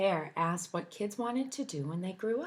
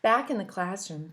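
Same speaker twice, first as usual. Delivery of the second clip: plain reading voice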